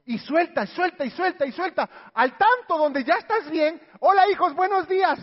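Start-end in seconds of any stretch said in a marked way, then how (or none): 4.0-5.2 saludo cantarín, mucho más agudo y fuerte; alegre exagerado. Acted. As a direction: entra a la cocina feliz y exagerada. Madre actuada saludando alegre. (madre saludando exageradamente alegre)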